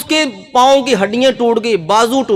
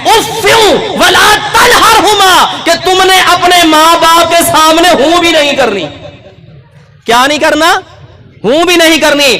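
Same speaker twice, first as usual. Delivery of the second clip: impassioned sermon shout; full-force preaching; 7 semitones higher, 4 dB louder than usual. Acted. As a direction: proclaim it to the whole hall.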